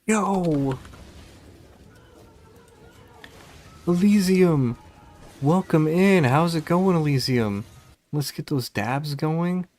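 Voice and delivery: loud, high hype greeting; streamer raid welcome. Acted. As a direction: welcome them in with energy.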